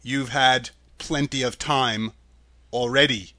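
ordinary delivery, nothing marked

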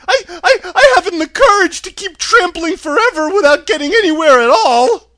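loud, high tearful wail; hammed-up crying complaint, very high; Cowardly Lion. A narrator's reading. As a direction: whimper it loudly, stammering into a long wail. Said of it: "said the Cowardly Lion with a whimper".

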